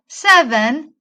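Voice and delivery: loud, emphatic SEVEN; emphasis on SEVEN. Shown as a demonstration. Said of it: "the emphasis on the word seven".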